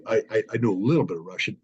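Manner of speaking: ordinary talk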